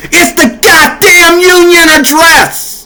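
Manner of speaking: slow, high, hammering outrage; mad. Real, not acted. Fuming at the Union address crowd, slow and high. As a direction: let the anger climb, drag every word. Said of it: "Now I'm mad".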